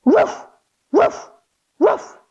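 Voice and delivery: short barking woofs; dog barks. Shown as a demonstration. Teacher leads kids barking in downward dog. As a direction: bark like a playful puppy. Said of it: "give me three big barks".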